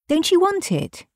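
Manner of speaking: high-pitched, puzzled question; mildly puzzled, high-voiced query. A narrator's reading. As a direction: ask it pitched well up. Husband questions his wife.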